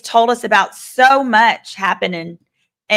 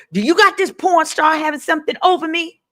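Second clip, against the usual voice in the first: raised higher, hammed-up suspicious [name]; mock [name] voice; 8 semitones higher, faster than usual. Retelling trial testimony, a higher hammed-up [name]. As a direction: play the paranoid mogul, pitched up.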